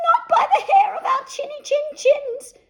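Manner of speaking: very high, loud, laughing squeal; three little pigs taunting the wolf. A narrator's reading. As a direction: shout it like tiny pigs. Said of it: "said the three little pigs".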